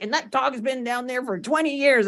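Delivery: high-pitched retold old man's line. Retelling the movie's old man about the dog, mock old-timer.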